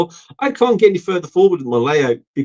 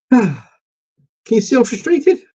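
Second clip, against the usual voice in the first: frustrated; 9 semitones higher than usual. Real, not acted. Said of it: "Of course I'm getting frustrated".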